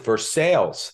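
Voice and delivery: Versailles as ver-SALES; local pronunciation. Shown as a demonstration. Host explaining how locals pronounce it, ver-SALES. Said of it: "they pronounce it".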